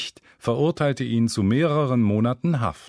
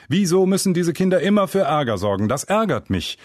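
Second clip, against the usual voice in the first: loud, fast, higher scolding rant; angry scolding; 8 semitones higher, 4 dB louder than usual. A narrator's reading. Father scolding the kids who made him late, loud and fast. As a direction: fume at the kids, voice climbing. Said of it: "schimpft er laut".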